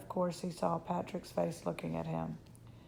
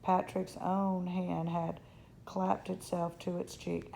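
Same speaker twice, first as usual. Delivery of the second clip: ordinary delivery, nothing marked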